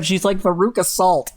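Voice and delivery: ordinary talk, nothing marked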